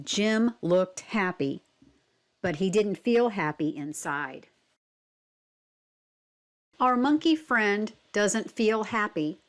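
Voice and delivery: ordinary delivery, nothing marked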